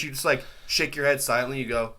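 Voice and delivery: ordinary talk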